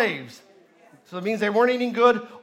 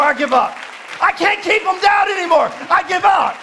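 higher, louder shouted preaching; preaching at full force; 6 semitones higher, 7 dB louder than usual. Acted. Pastor stirs the congregation, shouted preaching. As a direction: build to a roaring climax.

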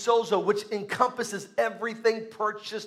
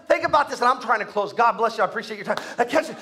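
ordinary talk, nothing marked

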